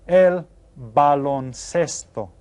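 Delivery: el baloncesto drawn out slowly, syllable by syllable; slow model word. Shown as a demonstration. Teacher reveals el baloncesto slowly. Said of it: "raise your hand and tell your teacher".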